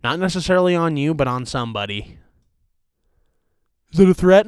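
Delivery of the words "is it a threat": higher, louder mock question; mock viewer question. Imagined viewer asking the host, higher and louder. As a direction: ask it as an alarmed viewer.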